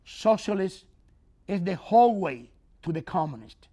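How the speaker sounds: usual speaking voice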